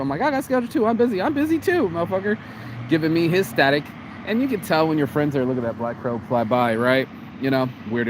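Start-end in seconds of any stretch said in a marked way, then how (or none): 0.2-2.4 much higher-pitched, heated comeback; indignant retort. Retold or imitated. Retelling his comeback to a busy friend, indignant. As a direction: argue back in a high, exasperated voice.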